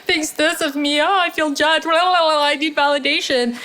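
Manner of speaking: mock your own insecure story, whining; whiny inner voice. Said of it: "I tell a story of".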